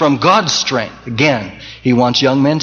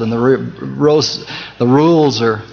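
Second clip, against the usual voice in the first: tripping over "roles are reversed"; false starts, then a careful recovery; tongue-tied stumble; 2 semitones lower than usual. Real, not acted. Preacher stumbling over his own sermon line, tongue-tied. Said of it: "that was a tongue twister".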